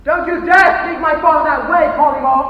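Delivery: loud, slow, outraged shout; furious shout. Acted. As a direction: spit each word back at him. Furious reply to an insult.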